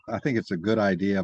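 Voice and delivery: usual speaking voice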